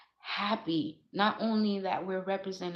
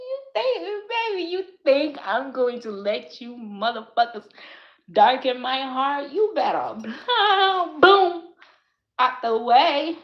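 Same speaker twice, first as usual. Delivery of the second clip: defiant loud rant; 6 semitones higher, 9 dB louder than usual. Real, not acted.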